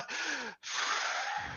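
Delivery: long unvoiced puff through the lips; mustache-puffing sigh. Flustered guard puffing out a sigh, unvoiced. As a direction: blow the breath out through the mustache. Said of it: "see his little mustache just kinda blow out".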